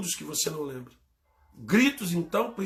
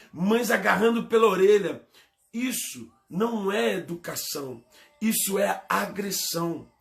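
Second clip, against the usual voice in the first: forceful, raised preaching voice; impassioned preaching; 5 semitones higher, 4 dB louder than usual. Real, not acted. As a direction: preach it hard, phrase by phrase. (pregação inflamada)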